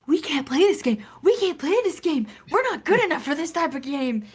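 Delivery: mock wail of giving up; retold panic. Mother and daughter after boss fight, retold panicked wailing. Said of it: "our hearts are pounding".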